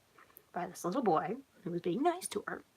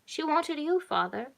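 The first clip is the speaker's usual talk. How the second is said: lightly voiced little girl, higher pitch; a hint of a girl speaking for the group; child speaking up; 7 semitones higher, slower than usual. A narrator's reading. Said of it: "girl with light pink buttons spoke up".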